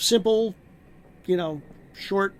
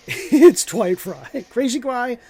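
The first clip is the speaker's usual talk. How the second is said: laughing; 6 semitones higher, 10 dB louder, much slower than usual. Real, not acted. Laughing about a crazy actor.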